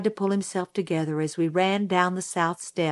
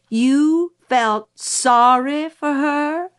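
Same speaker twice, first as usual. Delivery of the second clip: higher, louder, very slow incredulous repeat; outraged disbelief; 8 semitones higher, 5 dB louder, slower than usual. Acted. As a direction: stretch every word in mounting outrage. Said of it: "seemed ready to rise to the ceiling".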